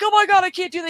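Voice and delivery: high-pitched frantic girlfriend imitation; mock panic. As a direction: plead in mock terror. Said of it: "she's like".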